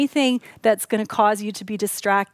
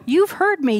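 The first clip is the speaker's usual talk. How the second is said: "You've heard me" higher, punching "You've"; higher, eager "You've heard me"; emphatic repeat; 6 semitones higher than usual. Retold or imitated. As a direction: repeat it with lifted delight. Emphatic repeat of relieved quote.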